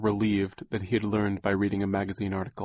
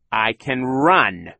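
loud, slow, raised villain voice; gloating villain; 4 semitones higher, 9 dB louder, much slower than usual. Acted. Olaf answers Poe before escaping, gloating. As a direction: draw it out loudly, savouring the answer.

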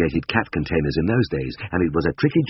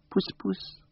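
soft, high cat-coaxing coo; 9 dB quieter than usual. A narrator's reading. Vet coaxes the cat on the branch, cooing. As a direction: coo it softly to lure a cat. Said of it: "I cooed".

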